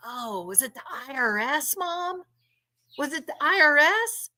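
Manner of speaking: mild clueless imitation; innocent son. Acts out innocent-sounding son.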